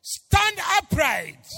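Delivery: "Stand upright" called out high, slow and loud; slow, loud apostle's command "Stand upright". Acted. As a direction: boom "Stand upright" like a command to a lame man. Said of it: "Paul said with a loud voice".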